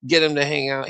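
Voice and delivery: usual speaking voice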